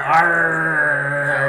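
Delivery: loud hammed-up pirate growl. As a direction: bellow it like a pirate. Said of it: "the pirate voice".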